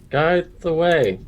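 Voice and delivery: slow, high and loud mock repeat; mocking echo. Bird mocking the hippie's motto. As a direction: parrot his words back with scorn.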